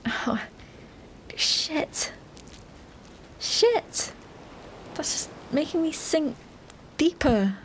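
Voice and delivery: panicked gasps while sinking; sinking in quicksand. Acted. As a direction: struggle and panic as you sink.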